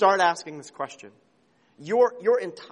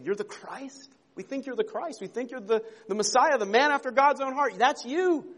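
higher, rising eager answer; eager disciples; 7 semitones higher than usual. Retold or imitated. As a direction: blurt out the discovery.

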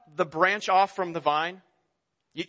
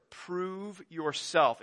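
slow weighty quote; 5 dB quieter, much slower than usual. Shown as a demonstration. Two words quoted as burden.

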